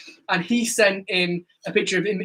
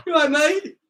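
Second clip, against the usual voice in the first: mock concern; 8 semitones higher than usual. Real, not acted. Teasing the gagging friend.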